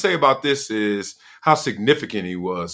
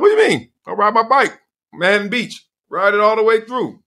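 high defensive protest; 9 semitones higher, 4 dB louder than usual. Retold or imitated. Defensive self quoted.

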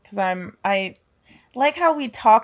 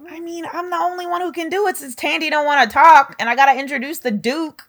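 rushing, higher protest; fast and excited; 6 semitones higher, faster than usual. Real, not acted. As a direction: talk fast to win the point. Defends doing the roll.

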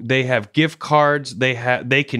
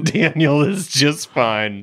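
slow mock reassurance; 2 semitones higher, slower than usual. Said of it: "I want her to know".

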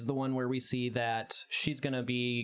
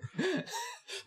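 high breathy giggle; embarrassed laugh; 3 dB quieter than usual. Real, not acted. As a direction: laugh it off, flattered. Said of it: "you're a really good writer".

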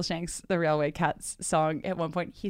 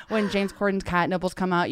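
normal talking voice

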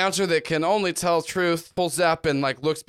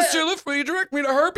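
put-on high, quick, nervous little-girl voice; a girl rushing a polite question; high fast timid girl; 10 semitones higher, faster than usual. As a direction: blurt it out fast like a scared girl. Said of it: "And then she".

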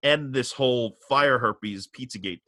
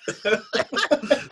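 laughing; 11 semitones higher than usual. Real, not acted. Laughing at his own threat.

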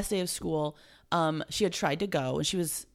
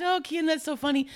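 light laugh; 11 semitones higher, 4 dB louder than usual. Retold or imitated. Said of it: "at first I just sort of laughed".

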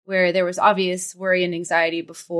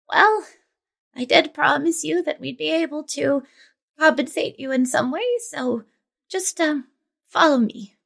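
put-on a light, higher voice for an old healer; old woman voice; 8 semitones higher than usual. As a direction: play the kindly old village woman.

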